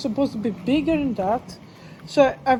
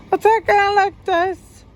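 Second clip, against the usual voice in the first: much higher, louder demo of frantic talk; demonstrating agitated talk, intensified; 9 semitones higher, 5 dB louder than usual. Shown as a demonstration. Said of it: "you could sometimes talking like this".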